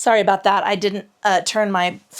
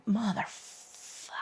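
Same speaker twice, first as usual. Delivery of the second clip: muttered swear under breath; whispered curse; 14 dB quieter, slower than usual. Real, not acted. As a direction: whisper it through the strain. Swears under breath mid-stretch.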